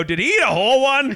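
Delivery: high, loud incredulous question; mock alarm. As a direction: call it out in amused disbelief. Overheard friend's alarmed question, acted.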